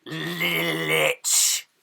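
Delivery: low, rasping voice from the bag; low raspy whisper from inside a bag. Acted. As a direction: rasp it out like something dead. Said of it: "a raspy voice respond".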